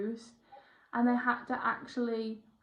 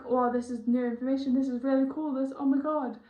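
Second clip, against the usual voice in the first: excited; 2 semitones higher, 5 dB louder than usual. Retold or imitated. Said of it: "I get so excited about what I'm channeling".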